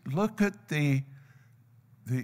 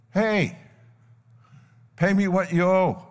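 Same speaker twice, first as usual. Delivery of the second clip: higher, insistent acted demand; 4 semitones higher, 3 dB louder than usual. Forgiven servant spots his debtor, demanding. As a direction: call him out and demand payment.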